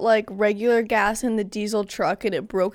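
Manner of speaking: ordinary delivery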